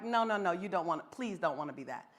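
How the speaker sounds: low, hushed cringing protest; gently pleading them out of it, voice dropped; cringing plea. Retold or imitated. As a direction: wince through a soft, low plea. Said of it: "cringed like".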